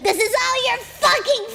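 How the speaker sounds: high, loud shrieking blame, laughing; mock furious yell. Calling a friend to blame him, mock furious yell.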